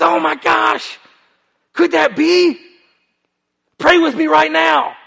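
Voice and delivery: a man suddenly terrified of hell, begging; freaked out. Retold or imitated. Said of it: "And he freaked out".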